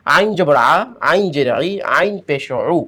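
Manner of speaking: গলার ভিতর থেকে আইন, তাড়াতাড়ি; throat-voiced ain. Shown as a demonstration. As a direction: say আইন from the back of the throat, clipped vowels আ ই উ. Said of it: "গলার ভিতর থেকে উচ্চারণ".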